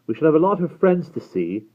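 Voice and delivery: high, forced-loud remark; rather too loud. A narrator's reading. Simon remarking on friends with Will nearby, rather too loudly. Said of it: "said Simon rather too loudly".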